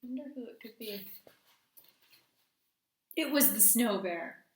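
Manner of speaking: storytime read-aloud with hushed suspense; gentle picture-book narration; reading to children. A narrator's reading. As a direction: read it like bedtime story time for the class. Teacher reads story aloud.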